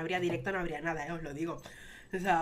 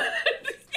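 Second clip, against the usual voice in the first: high breathless laugh; high airy laugh. Real, not acted. Laughing at herself, a high airy giggle.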